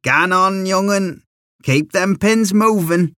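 mock yell in a high voice; yelled encouragement. A narrator's reading. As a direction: call out to egg on a young runner. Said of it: "he'd yell".

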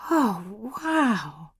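breathed in wonder, high; breathy. Acted. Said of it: "Georgia breathed".

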